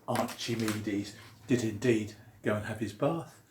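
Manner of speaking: ordinary delivery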